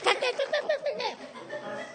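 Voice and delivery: high squeaky yap of a small dog; mock little dog yelp. Preacher imitates a little Western dog: high squeaky yap. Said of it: "and he goes".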